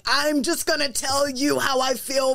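high-pitched, lightly angry declaration; a touch of performed anger; mock angry comic. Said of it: "The angry, like".

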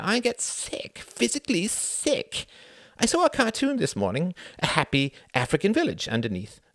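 sickened by the news; Sam, upset politician. Acted. Sam telling Cuthbert about the cartoon, high and distressed.

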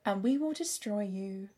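measured villain's threat, lightly voiced; imitated possessed menace. Host retells the Mind Flayer's threat, quietly menacing. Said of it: "turn around and be like".

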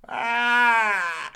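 imitated crow call, high and drawn out; Graham Kennedy crow call. Footy fan retells a player's first words, mock crow call. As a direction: mimic a long, loud, high crow cry. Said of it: "the old Graham Kennedy crow call".

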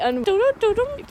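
high honking doo-doo-doo-doo; clown horn impression. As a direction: honk it like a clown car horn. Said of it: "my horn impression".

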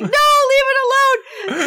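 put-on high, slow, pleading child's voice with a laugh in it; pleading little girl. Ophelia begging stepfather to leave the mandrake, high pleading child. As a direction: play the frightened girl begging him to leave it. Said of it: "she's like".

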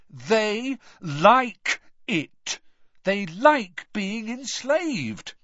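very slow, high, word-by-word emphasis; loud. A narrator's reading. Ron drumming his point into Hermione, loudly. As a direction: drum it in one word at a time. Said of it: "said Ron loudly".

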